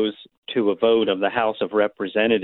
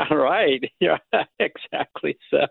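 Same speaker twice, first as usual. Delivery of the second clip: laughing; 6 semitones higher, slower than usual. Real, not acted. Laughs at host's joke.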